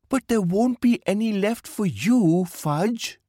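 put-on high-pitched protesting child; young Meenu. A narrator's reading. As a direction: object in a squeaky little girl's voice. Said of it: "said Meenu".

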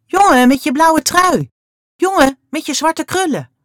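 loud, high repeated call; calling again. A narrator's reading. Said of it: "roept ze nog eens". (nog eens luid roepen)

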